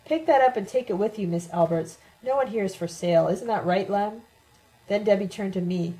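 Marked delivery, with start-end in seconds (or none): none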